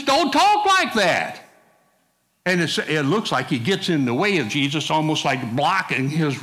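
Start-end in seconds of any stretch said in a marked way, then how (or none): 0.0-1.4 high, slow, insistent rebuke; Peter protesting. Retold or imitated. Preacher playing Simon Peter rebuking Jesus, high and slow. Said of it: "So he tells Jesus".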